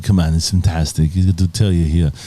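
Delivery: put-on low, thick Austrian accent; Arnold. Co-host demonstrating his impression, Austrian accent. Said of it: "Arnold's always".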